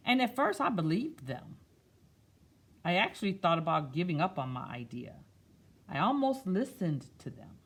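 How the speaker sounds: picture book voice; reading to children. Acted. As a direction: read it gently to a class. Reads picture book aloud.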